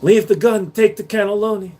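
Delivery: lightly voiced Godfather gangster; a touch of movie mobster; mobster line. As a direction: give it like a film mobster.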